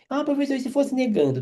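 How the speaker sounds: quick high voice of a curious student; mock student butting in with a question; student asking. Teacher mimics a student asking about negation, quick and high.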